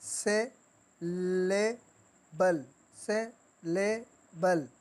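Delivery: Syllable drawn out in three separate beats; three-syllable split. Shown as a demonstration. Teacher counting three sounds, syllable broken syllable by syllable. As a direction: pause between सि, ले and बल. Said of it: "three sound".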